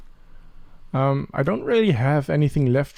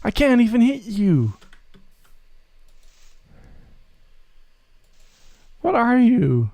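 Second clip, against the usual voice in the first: exasperated outburst; 9 semitones higher than usual. Acted. To the unhittable mob, exasperated raised voice. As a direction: scold the thing you can't even hit.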